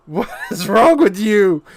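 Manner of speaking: the question broken by a real laugh; laughing disbelief. Real, not acted. Repeats it, laughing at AI.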